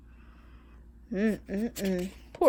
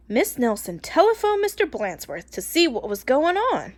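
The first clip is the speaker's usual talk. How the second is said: ordinary delivery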